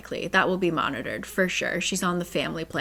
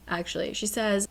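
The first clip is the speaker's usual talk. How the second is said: ordinary delivery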